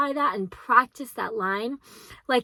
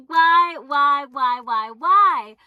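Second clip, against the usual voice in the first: loud, pleading repeated why; frustrated loud whys; exasperated whining why; 3 semitones higher, 7 dB louder, much slower than usual. Real, not acted. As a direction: whine it at the ceiling.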